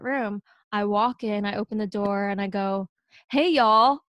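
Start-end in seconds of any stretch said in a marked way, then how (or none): 3.3-4.0 higher, louder Southern greeting; Southern accent. Retold or imitated. Walking into the interview room to the judges, Southern accent. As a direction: greet them with a loud Southern twang. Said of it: "super Southern".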